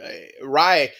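normal talking voice

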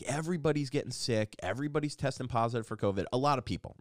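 ordinary delivery

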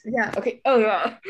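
talking through a brain freeze; words strained by a brain freeze. Real, not acted. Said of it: "I got a brain freeze".

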